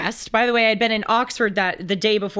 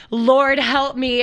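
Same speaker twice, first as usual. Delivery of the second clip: slow, louder mock plea; mock prayer; 4 semitones higher, 5 dB louder, much slower than usual. Reacting to the friend's trafficking text, slow mock plea. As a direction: drag it out as a desperate prayer. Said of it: "I'm like".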